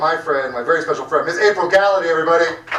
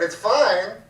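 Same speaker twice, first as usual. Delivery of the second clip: usual speaking voice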